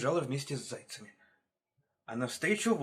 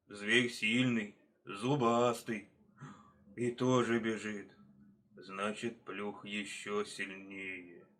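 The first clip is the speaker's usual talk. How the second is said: slow, low bear voice; lumbering, slow deliberation; pondering bear; 4 semitones lower, slower than usual. Acted. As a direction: mull it over ponderously, drawing words out. Bear thinking things over.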